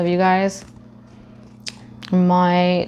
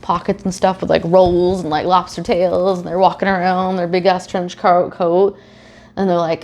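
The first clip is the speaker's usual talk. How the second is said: ordinary talk, nothing marked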